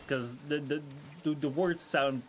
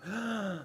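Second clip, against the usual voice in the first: surprised gasp; 5 semitones higher, 4 dB quieter than usual. Real, not acted. Finds item in wooden crate.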